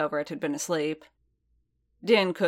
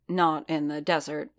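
plain reading voice

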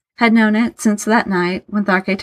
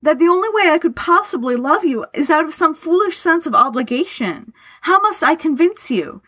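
impassioned, high-pitched pleading; trembling outcry; 9 semitones higher than usual. A narrator's reading. Laurence begs his friend to believe his love, anguished outcry. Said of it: "cried, a tremble in his voice".

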